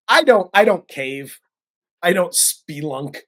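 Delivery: normal talking voice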